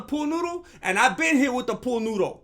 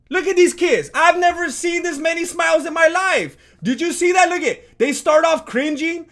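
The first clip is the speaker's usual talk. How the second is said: excited; 6 semitones higher, 4 dB louder than usual. Real, not acted. Gleeful reaction to training footage.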